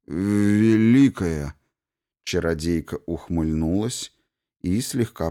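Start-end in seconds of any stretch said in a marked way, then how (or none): 0.1-1.5 drawn-out plea. A narrator's reading. Matthew pleads, drawn out.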